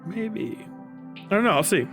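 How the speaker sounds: ordinary delivery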